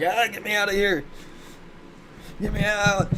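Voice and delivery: high-pitched mock panic, begging to escape; hammed-up high shriek to get out; mock panicked shriek. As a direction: squeal it like you're desperate to flee, half joking. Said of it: "and I'm like".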